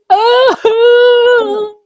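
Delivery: loud high tearful cry; a burst of happy tears. Retold or imitated. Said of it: "I was, like, crying".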